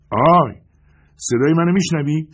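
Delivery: loud, high call into the dark. Acted. Narrator calls into a dark hole, loud and high. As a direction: shout into the hole to find someone.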